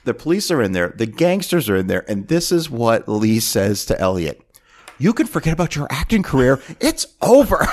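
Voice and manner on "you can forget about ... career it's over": mock furious tirade. Producer telling off his assistant in front of police, mock fury. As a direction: play a boss ending a career, hammed up. Acting Lee berating Elliot.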